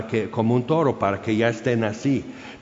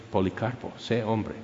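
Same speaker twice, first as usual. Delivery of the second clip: softly and solemnly summon him, as a heavenly voice; voice from above; 7 dB quieter than usual. Retold or imitated. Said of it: "oyen una voz encima del". (voz de lo alto, baja y queda)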